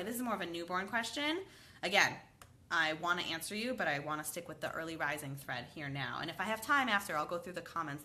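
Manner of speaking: ordinary talk, nothing marked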